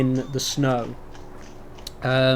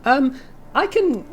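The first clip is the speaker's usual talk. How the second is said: put-on much higher, gushing girly voice; high girly half-orc; 14 semitones higher than usual. Half-orc blacksmith gushes at the bard in a high girly voice. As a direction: gush like an excited schoolgirl. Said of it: "she's like".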